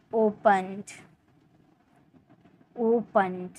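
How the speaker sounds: Opened low, with a light pond; unstressed ending. Shown as a demonstration. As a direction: let the ending of opened fall softly. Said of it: "इसमें जोड़ नहीं दे रहे हम". (Opened, आख़िरी हिस्से पर ज़ोर नहीं)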